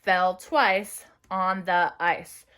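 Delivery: very slow sentence; slow dictation. Shown as a demonstration. Teacher dictating second sentence. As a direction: stretch each word for writers. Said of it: "Again with me".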